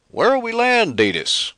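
high, loud call across the ship. Acted. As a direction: call out from another room. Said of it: "Mado called from the control cabin".

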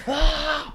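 high sharp shout of effort; mock punch yell. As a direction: cry out like throwing a punch. [name] punching the wall.

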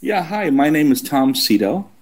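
ordinary delivery

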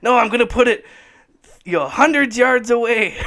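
high, loud, comic shouting of the golf inventor; mock shout. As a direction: belt it out like a silly drunk inventor. Retelling comic's golf inventor rant.

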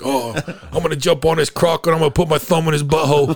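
hammed-up Australian TV parody; Australian accent. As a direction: parody an Australian croc wrangler. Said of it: "South Park".

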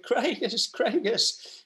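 put-on high-pitched mother calling her child's name; imitated mum. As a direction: play an anxious-to-hear mum on the phone. Acted mum coming on the phone.

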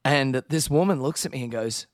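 usual speaking voice

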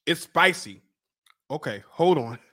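normal talking voice

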